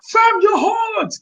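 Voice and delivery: high drawn-out praise; mock hype reaction. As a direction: gas him up like a stunned listener. Imagined crowd reacting to bars.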